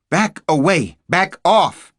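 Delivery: shouting at armed agents to back off; yelled. A narrator's reading. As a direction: lose it and yell them off. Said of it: "I lost it and yelled at them".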